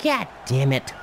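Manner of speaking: imitating a mad player, lightly; half-hearted angry mutter for her; a touch of her frustration; mock mad cursing. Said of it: "she looks real mad".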